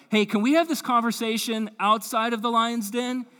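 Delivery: a hint of panic, half joking; mock freaked out. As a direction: play it as a comic nervous plea. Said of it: "if he was freaked out".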